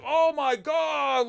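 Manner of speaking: high, drawn-out mock amazement; overwhelmed awe. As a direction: stretch it out, overwhelmed by something enormous. Awed by giant IMAX screen.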